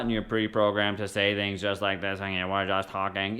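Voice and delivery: monotone robot voice. Shown as a demonstration. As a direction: talk like a pre-programmed machine on one note. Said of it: "Unless you're a robot".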